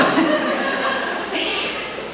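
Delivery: real laugh after the impression; breathy laugh. Real, not acted. Speaker laughing at her own Creek women impression, a breathy laugh.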